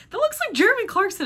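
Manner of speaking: high, loud, quick exclamation; excited recognition. Retold or imitated. Spotting a celebrity on the trivia screen, excited recognition. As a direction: blurt it out, pitch shooting way up.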